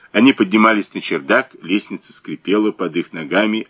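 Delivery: plain reading voice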